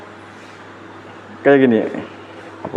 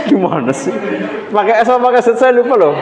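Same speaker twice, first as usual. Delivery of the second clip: laughing; 10 semitones higher than usual. Real, not acted. Lecturer forgets the author's spelling, laughing.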